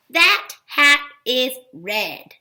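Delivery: slow, word-by-word reading. Shown as a demonstration. Teacher reads a second practice sentence, slow read-out. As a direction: give every word its own space.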